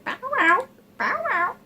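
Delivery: imitating the parrot. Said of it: "or if it's going".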